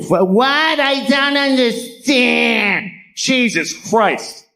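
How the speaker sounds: high, drawn-out mock-bewildered wail; baffled old diner. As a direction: exaggerate an exasperated old customer who can't cope. Old diner baffled by code menu.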